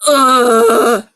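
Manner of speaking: loud disgusted ugh; disgusted groan. Real, not acted. Disgusted at reading cringe fic.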